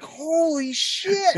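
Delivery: high, long drawn-out marvelling; mock amazement. Onlookers amazed at the father's fart, high drawn-out awe. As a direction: stretch it out slowly way up high.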